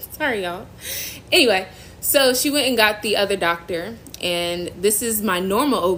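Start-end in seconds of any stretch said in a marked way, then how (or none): 0.1-0.7 teary, breaking apology to viewers; real crying, sorry to the viewers; tearful apology. Real, not acted. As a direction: apologize through tears. Crying on live stream.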